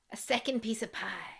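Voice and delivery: dramatic whisper; hushed mock-drama. Recapper announces the pie to co-hosts, hushed mock-drama. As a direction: whisper it as a mock-solemn reveal. Recap mocks the pie's return.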